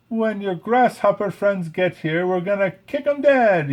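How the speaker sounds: high, loud tough-guy brag; bragging. A narrator's reading. Francis bragging to the ants about fighting. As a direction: boast like a cocky ladybug. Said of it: "he bragged".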